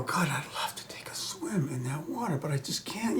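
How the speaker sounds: hushed, unvoiced whisper; whispered wish. Longing for a forbidden swim, whispered. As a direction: breathe the wish out, barely audible. Inner wish voiced as thought.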